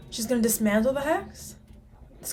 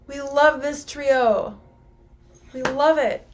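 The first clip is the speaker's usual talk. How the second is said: loud, drawn-out delight; excited gushing; 4 semitones higher, 8 dB louder, slower than usual. Real, not acted. Fan cheering on the trio, gushing excitedly. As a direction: gush with emphasis on love. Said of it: "Sorry, I'm excited, guys".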